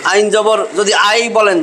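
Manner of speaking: usual speaking voice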